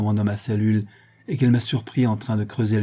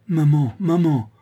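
higher, slow, drawn-out cry; distressed call; 6 semitones higher, 4 dB louder, slower than usual. A narrator's reading. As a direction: call out to mother in shock. Child shocked by grandfather's death.

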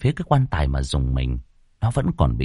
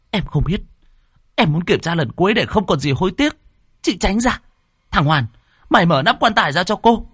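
high-pitched, stubborn demand; heated insistence; 10 semitones higher than usual. A narrator's reading. Lan refuses to back down and orders Hoàn, heated insistence. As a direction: argue back, then order the boy sharply.

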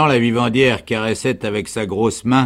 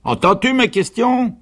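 very high, loud challenge; demanding sergeant; 13 semitones higher, 7 dB louder than usual. A narrator's reading. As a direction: demand an answer at the top of your voice. (sergent impatient, cri aigu et fort)

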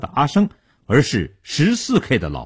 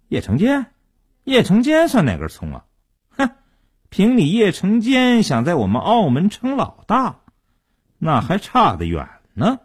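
high-pitched, derisive character voice; scornful sneer; 9 semitones higher than usual. A narrator's reading. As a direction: sneer down at a captive who thinks himself boss. Kidnapper sneers at captive's plea. (轻蔑地高声嘲讽)

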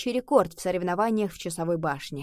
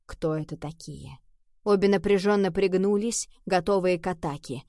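ordinary delivery, nothing marked

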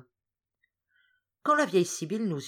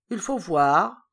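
louder, weighty judgement; connoisseur's reply; 8 dB louder, slower than usual. A narrator's reading. Hippel deciding which wine to taste, louder. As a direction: pronounce it like an expert taster. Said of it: "répondit Hippel".

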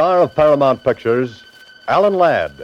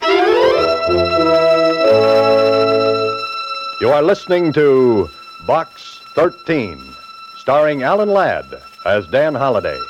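booming announcer; 8 semitones lower than usual. Acted.

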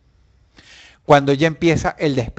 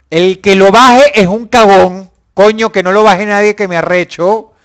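loud; 4 semitones higher, 8 dB louder than usual. Real, not acted. Ordena no bajar el volumen.

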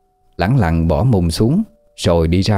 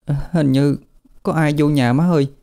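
put-on higher, small trembling child voice; a frightened kid murmuring to her mom; lightly voiced scared daughter; scared little girl; 7 semitones higher than usual. A narrator's reading. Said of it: "Nó run run nói nhỏ vô lỗ tai của má nó".

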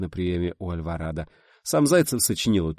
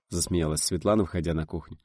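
plain reading voice

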